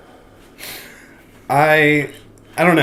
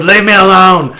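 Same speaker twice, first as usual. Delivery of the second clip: quoting the man; 6 semitones higher, 11 dB louder than usual. Retold or imitated. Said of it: "He goes".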